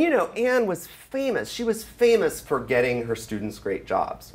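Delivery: ordinary talk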